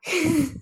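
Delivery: high, short chuckle; helpless giggle after mocking; trailing laugh of an amused bear; bear's laugh. A narrator's reading. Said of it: "Smál se medvěd".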